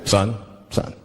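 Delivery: very low, stern officer voice; mock authority, deep and deliberate; police officer. Police officer questioning a driver pulled over, deep cop voice. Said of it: "the police pull me over".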